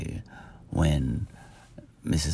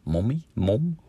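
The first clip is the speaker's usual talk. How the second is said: quizzically trying out American "mommy" and "mom"; imitating American pronunciation; American accent; 2 semitones higher than usual. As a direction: say "mom" with an American open vowel. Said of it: "the way they say that".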